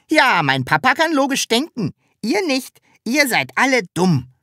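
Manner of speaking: put-on high, loud childlike creature voice; cheeky Sams. A narrator's reading. Sams to onlookers, boasting of its papa, cheeky. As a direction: crow it high and proud at the onlookers.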